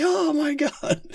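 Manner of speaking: cartoon falsetto gasp; shrieking in shock. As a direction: squeal it in mock horror. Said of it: "It's like".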